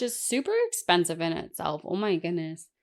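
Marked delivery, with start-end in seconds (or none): none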